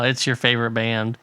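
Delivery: normal talking voice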